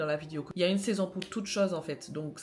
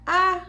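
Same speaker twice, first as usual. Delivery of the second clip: loud high-pitched yelp of dismay; sudden cry of disappointment; shocked cry; 11 semitones higher, 13 dB louder than usual. Real, not acted. Discovers mask must be chilled.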